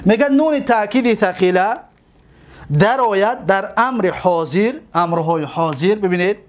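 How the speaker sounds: usual speaking voice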